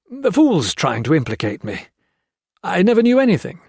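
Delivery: usual speaking voice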